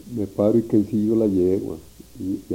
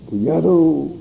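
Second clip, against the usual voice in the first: loud call across the distance; yelling across the field; shouted call; 8 semitones higher, 6 dB louder, slower than usual. Retold or imitated. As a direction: call out to him from far. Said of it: "que le grito".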